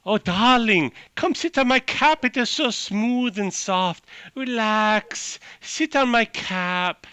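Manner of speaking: high, slow, coaxing voice; seductive mushroom. Acted. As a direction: lure the traveller to sit and rest. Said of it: "divides into individual voices. It's like".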